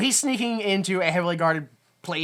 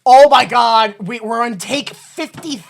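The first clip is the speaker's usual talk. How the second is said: loud exasperated rant, pitched up; fed-up director; 7 semitones higher, 9 dB louder than usual. Retold or imitated. Director fed up on take fifty-nine, ranting loudly. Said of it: "they were like".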